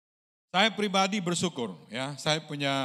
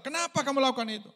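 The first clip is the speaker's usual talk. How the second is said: fast, higher, sharp challenge; Samuel's rebuke; 10 semitones higher, 3 dB louder, faster than usual. Retold or imitated. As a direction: demand an answer from the king.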